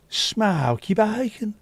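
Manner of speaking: high, slow Brummie "Smoky bacon"; drawn-out smoky bacon. Shown as a demonstration. Streamer replaying the joke that made Diane giggle, high Brummie drawl. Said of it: "mouth the word smoky bacon".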